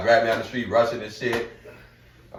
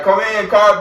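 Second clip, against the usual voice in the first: calling out; 7 semitones higher, 8 dB louder, slower than usual. Retold or imitated. Calling out over shop intercom.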